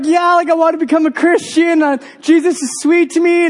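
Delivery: high-pitched imitation of an emotional teen; hammed-up crying girl, pitched high; tearful teenage girl. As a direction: gush it like a weepy new convert. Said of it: "who's crying over there".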